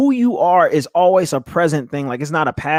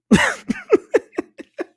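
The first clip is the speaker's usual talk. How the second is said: high giggle. Real, not acted. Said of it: "act a fool a little bit".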